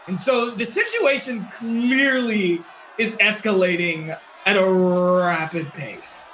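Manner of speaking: ordinary talk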